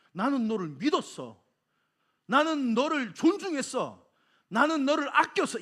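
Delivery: building to loud, high insistence; impassioned protest. Acted. Master telling servant he trusted him, rising impassioned protest.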